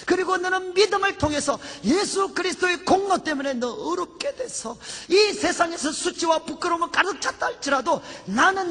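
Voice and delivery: high, forceful preaching as the Lord; impassioned voice of God. Retold or imitated. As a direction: pour out God's affirmation with mounting fervor. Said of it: "주님이 그때 제 마음속에 말씀해 주셨어요".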